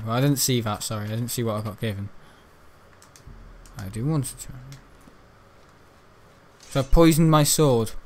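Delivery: normal talking voice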